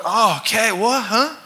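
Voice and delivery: lightly voiced half-asleep mumble; a hint of a drowsy, just-woken man; groggy waking. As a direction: stumble awake, confused.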